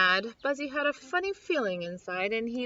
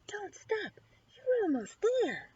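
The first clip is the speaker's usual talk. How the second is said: encouraging voice; 9 semitones higher than usual. Acted. Mysterious voice encouraging Buzzy.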